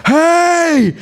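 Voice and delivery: a sudden high shout of Hey; calling out. Retold or imitated. A passenger shouting to the man leaving the train. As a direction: yell to stop him. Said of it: "one person goes".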